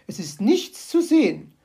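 the magpie says it, huffily. Acted. Magpie voice vs narration.

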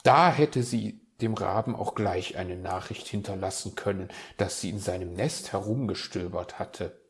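ordinary delivery

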